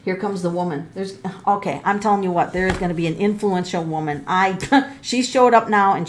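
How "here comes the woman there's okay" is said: usual speaking voice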